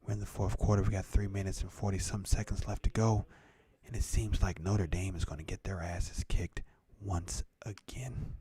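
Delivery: hushed, mellow, slowed down with long pauses; mock ASMR host. Streamer calling the football game as ASMR, hushed. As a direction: half-whisper the game commentary, calm and unhurried. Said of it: "we're transitioning to the A S M R".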